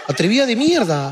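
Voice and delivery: hammed-up scolding, stretched out; mock indignation. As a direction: draw the insult out in pretend outrage. Acts abusive customer at shopkeeper.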